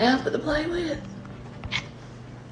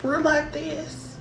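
quiet; 3 semitones higher, 7 dB quieter, slower than usual. Shown as a demonstration. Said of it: "demonstrating closeness".

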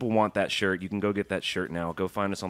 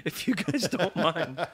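laughing; 10 semitones higher, much slower than usual. Real, not acted.

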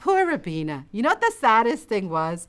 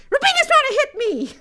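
put-on very high, loud little-kid complaint; acted squeal of a small tattletale; tattling child; 5 dB louder than usual. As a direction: tell on your sister to mom.

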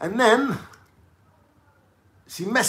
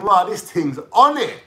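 impressed; 5 semitones higher, 6 dB louder than usual. Retold or imitated. Impressed reaction retold.

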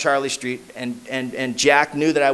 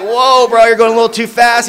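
louder and higher, mock alarm; pushing back; 7 semitones higher, 9 dB louder than usual. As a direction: hold him off with a raised voice. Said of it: "I was like".

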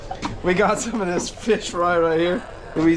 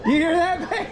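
laughing mock disbelief; 10 semitones higher than usual. Real, not acted. Mocking the same beer claim.

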